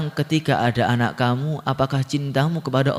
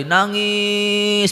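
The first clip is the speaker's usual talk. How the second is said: drawn-out word; 6 semitones higher than usual. A narrator's reading. Stretches the word for weeping.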